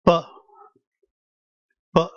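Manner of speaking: B as a short "buh"; isolated "buh" for B, spaced out; demonstrated sound. Shown as a demonstration. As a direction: say B as its sound, as in book. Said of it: "as the B in book".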